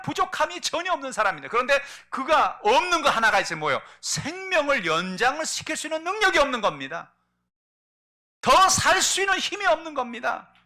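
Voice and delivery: high, loud, driving sermon; forceful preaching. Acted. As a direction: preach it out with full force to the congregation. (높고 크게 힘차게 설교)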